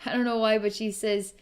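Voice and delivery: usual speaking voice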